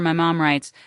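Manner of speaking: plain reading voice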